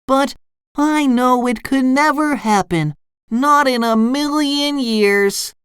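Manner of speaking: high, wistful cartoon voice; SpongeBob dreamily musing. A narrator's reading. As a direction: muse in a high voice, slowing at the end. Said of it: "SpongeBob dreamily asked his pet".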